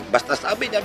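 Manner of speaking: ordinary delivery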